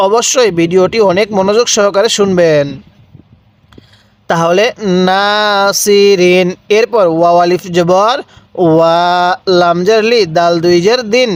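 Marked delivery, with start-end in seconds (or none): 5.1-6.5 "নাসিরিন" said slowly, "না" held one count; one alif elongation. Shown as a demonstration. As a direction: draw out "নাসিরিন" gently, one beat on না. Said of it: "খালি আলিফ এক আলিফ টানতে হয়". ("নাসিরিন" এক আলিফ টানে ধীরে)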